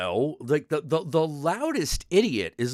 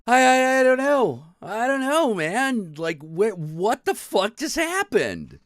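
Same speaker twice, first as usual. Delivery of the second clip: exasperated disbelief; 9 semitones higher, 5 dB louder, slower than usual. Real, not acted. Exasperated at the absurdity.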